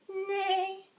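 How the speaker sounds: high, loud whinnying neigh; horse neigh. Shown as a demonstration. Teacher showing children the horse's sound, high neigh. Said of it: "and the horse says".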